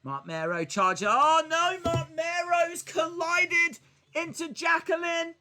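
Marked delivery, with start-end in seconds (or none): 1.1-5.4 alarmed yell. Real, not acted. Live reaction to in-game collision.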